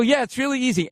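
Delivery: high, breezy assurance; over-eager. Retold or imitated. Fan tells star what he wants to hear, high over-eager voice. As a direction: send your pitch way up to sell it.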